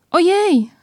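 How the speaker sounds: high, loud sudden "Ojej!"; startled cry. A narrator's reading. Stuck bear cries out in surprise. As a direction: yelp it out as the bear gets stuck. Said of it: "zawołał Puchatek".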